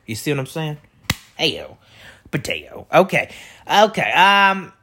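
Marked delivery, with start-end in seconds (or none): 1.4-2.9 catchphrase. Pretend. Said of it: "that's a new catchphrase".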